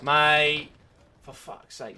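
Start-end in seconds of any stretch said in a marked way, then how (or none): 0.0-0.7 loud, higher, drawn-out "mate"; exasperated shout. Real, not acted. Player fed up with the match, exasperated shout. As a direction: call it out in exasperation. Frustrated outburst at game.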